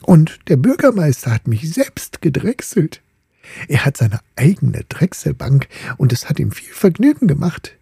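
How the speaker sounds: a hint of a proud young suitor; boasting top. The top showing off to the ball, lightly bragging.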